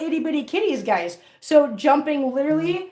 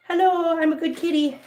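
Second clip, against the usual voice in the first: higher, playful pet voice; voicing the kitten; 5 semitones higher than usual. Owner answering for her cat, playful pretend kitty voice. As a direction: speak up sweetly for the cat. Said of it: "Say hello".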